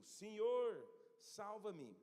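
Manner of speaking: loud, high cry for help; desperate cry. Acted. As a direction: cry out as if sinking in the water.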